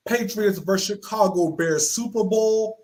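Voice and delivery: punchy dramatic cold-open read; wrestling show teaser with emphatic opening; show intro announcer. Acted. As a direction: announce it big, then pause before the payoff.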